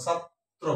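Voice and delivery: ছাত্র as 'chhat... tro', doubled t; correct pronunciation. Shown as a demonstration. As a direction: close ছাত্র on a round o after splitting it. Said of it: "সঠিক উচ্চারণ". (ছাত্... ত্রো, দ্বিত্ব ত)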